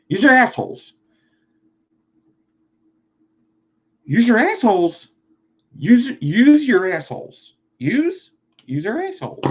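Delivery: ranting Philly local, hammed-up; mock Philly yell. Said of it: "mock Philly rant".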